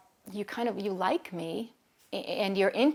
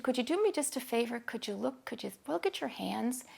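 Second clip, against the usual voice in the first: gentle coaxing; 4 semitones higher, 5 dB quieter than usual. Retold or imitated. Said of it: "joke around a little bit".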